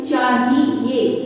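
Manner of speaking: चाहिए said very slowly; slow correct word. Shown as a demonstration. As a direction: draw चाहिए out. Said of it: "आपको कैसे लिखना चाहिए?". (चाहिए धीरे-धीरे)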